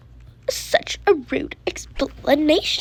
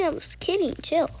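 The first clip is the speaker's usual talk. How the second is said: ordinary talk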